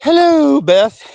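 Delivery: put-on high, loud old-lady falsetto hello; Mrs. Doubtfire voice. Imitating Ma greeting Beth in a Mrs. Doubtfire voice. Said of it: "in a Missus Doubtfire voice".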